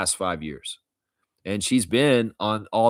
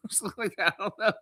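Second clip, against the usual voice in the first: laughing through the words, high; 11 semitones higher, 4 dB quieter than usual. Real, not acted. Streamer laughing at his own impression. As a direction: giggle it out after the bit.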